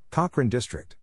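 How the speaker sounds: ordinary delivery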